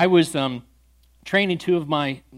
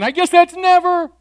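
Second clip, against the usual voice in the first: much higher, louder, slow playful punchline; teasing; 13 semitones higher, 9 dB louder than usual. Retold or imitated. Pastor teasing the atheist, hammed-up punchline. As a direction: land the joke with a big grin. Joking answer for the man.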